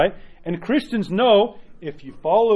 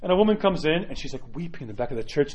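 ordinary talk, nothing marked

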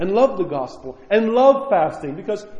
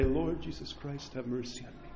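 quiet prayer; 3 semitones lower, 14 dB quieter than usual. Shown as a demonstration. Priest models the Jesus prayer on knees, very soft. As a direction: breathe the words before God. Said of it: "And say".

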